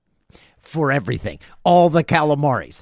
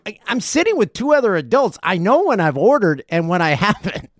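ordinary delivery

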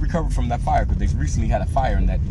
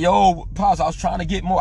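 put-on pleading kid; 9 semitones higher than usual. Boy pleads with his pops at the fish market, high and eager. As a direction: beg like an excited boy. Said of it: "I'm still like".

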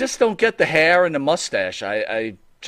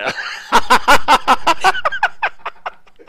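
a long, high burst of laughter; 4 dB louder than usual. Real, not acted. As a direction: laugh at your own silly accent. Laughs at own accent bit.

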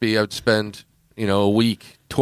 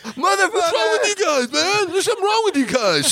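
very high-pitched hammed-up meltdown; mock outraged outburst. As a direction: imitate a guy losing it before storming off. Retold Teabag blows up, storms off.

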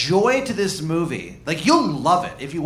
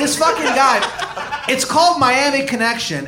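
higher and louder, laughing through it; excited laughing; 9 semitones higher, 3 dB louder than usual. Real, not acted. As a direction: gush about it, cracking up. Gushing, laughing about movie.

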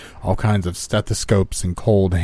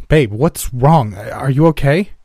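higher, louder worried questions; alarmed concern; 6 semitones higher, 5 dB louder, slower than usual. A narrator's reading. Tatum runs over to hurt partner, alarmed. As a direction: rush in worried, voice raised.

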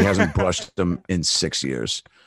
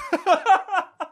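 laugh; 3 dB louder than usual. Real, not acted. Laughing at own joke.

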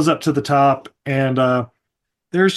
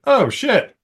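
shocked oh shit; 8 semitones higher than usual. Retold or imitated.

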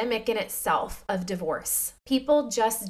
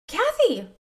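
high, loud happy call of her name; excited greeting; 10 semitones higher, 5 dB louder than usual. Retold or imitated. Greeting the old daycare lady, excited call. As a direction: call out to her, delighted to see her.

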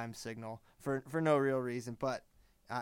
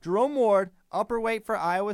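domineering; 7 semitones higher, 9 dB louder than usual. Real, not acted. Cuts off interrupting co-host.

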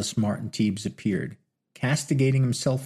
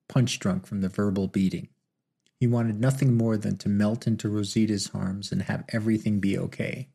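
ordinary delivery

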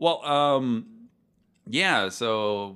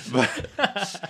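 usual speaking voice

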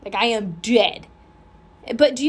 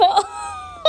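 breathy laugh; 9 semitones higher than usual. Real, not acted. Laughing at herself.

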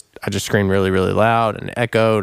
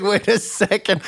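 sudden second thoughts; 10 semitones higher than usual. Retold or imitated. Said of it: "He's like".

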